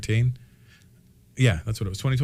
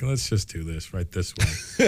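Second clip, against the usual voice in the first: Letterman impression; 6 semitones lower, 5 dB quieter, slower than usual. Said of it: "I'm more of a Letterman who's gonna be like".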